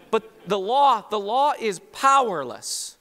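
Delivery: loud, high, forceful declaration; emphatic preaching. Real, not acted. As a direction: hammer it home from the pulpit.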